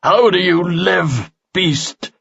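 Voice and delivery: a warrior confronting a dying monster, booming. A narrator's reading. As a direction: boom it at the monster. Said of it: "His voice boomed".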